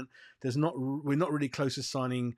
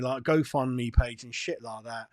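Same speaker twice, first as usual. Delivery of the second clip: ordinary talk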